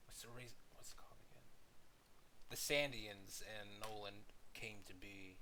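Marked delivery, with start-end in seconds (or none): 0.1-1.4 whispering. Real, not acted. Muttering to himself mid-sentence.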